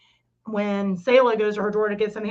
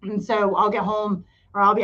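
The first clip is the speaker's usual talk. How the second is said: usual speaking voice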